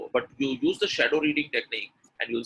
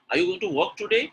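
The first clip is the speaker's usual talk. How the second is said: higher-pitched, surprised lift through "today"; rising pattern of surprise; 6 semitones higher, 3 dB louder, faster than usual. Shown as a demonstration. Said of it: "shows an emotion of surprise".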